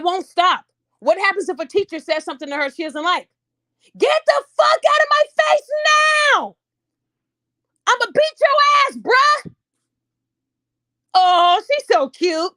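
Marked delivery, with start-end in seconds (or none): 4.0-9.5 high, loud threatening shout; furious yell. Acted. Imagined child yelling at teacher. 11.1-12.5 slow, loud gushing coo; mock cooing. Mocking those who find it cute.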